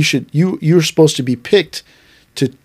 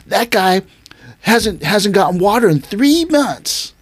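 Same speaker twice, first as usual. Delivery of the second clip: higher, slow, exaggerated tattling voice; mock whiny complaint; 6 semitones higher, slower than usual. Student tattling to sensei about water, hammed-up whine. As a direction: overplay the grievance like a tattler.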